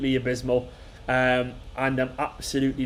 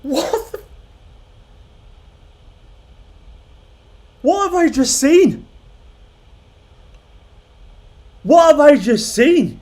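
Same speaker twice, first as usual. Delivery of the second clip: high, loud, drawn-out shocked cry; real high-pitched shock, words stretched out; stunned disbelief; 13 semitones higher, 8 dB louder, slower than usual. Real, not acted. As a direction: slowly wail it in utter disbelief, pitched way up. Stunned reaction to live match.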